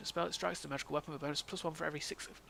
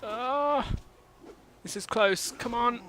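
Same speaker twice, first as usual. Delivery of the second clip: tense excitement; 8 semitones higher, 7 dB louder, slower than usual. Real, not acted. Tense fight, near death.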